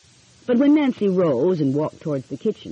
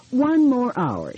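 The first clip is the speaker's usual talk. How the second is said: slow and drawn out; 7 semitones higher, slower than usual. A narrator's reading. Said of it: "Nancy sighed".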